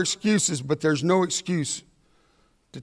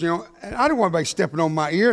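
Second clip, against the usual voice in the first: normal talking voice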